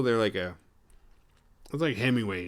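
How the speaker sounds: ordinary talk, nothing marked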